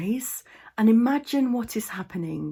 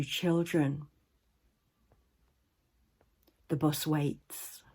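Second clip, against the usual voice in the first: guided meditation. Acted. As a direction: guide listeners into the imagined scene, unhurried.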